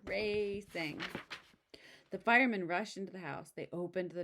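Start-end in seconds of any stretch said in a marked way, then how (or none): none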